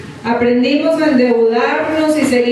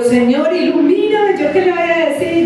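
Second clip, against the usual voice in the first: higher-pitched mock panic prayer; retold flustered plea; 4 semitones higher than usual. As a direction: plead as if caught without an answer. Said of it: "me quedé como loca y sin ideas".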